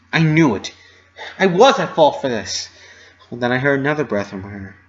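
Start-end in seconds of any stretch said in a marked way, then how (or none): 0.1-2.7 louder, despairing outburst; anguished self-blame. A narrator's reading. Dash blaming herself in front of Rarity, louder.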